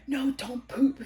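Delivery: lightly mocked husband objecting; alarmed husband. Husband stopping his nervous wife, alarmed.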